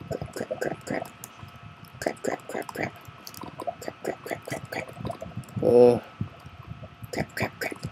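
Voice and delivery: rapid whispered crap, crap under the breath; frantic breathless muttering; panicked whisper. Real, not acted. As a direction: hiss it out in a hurry, barely audible. Panicked whispering while fleeing in game.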